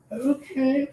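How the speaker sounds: high bemused okay. Retold or imitated. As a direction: react with bemused surprise. Bemused reaction.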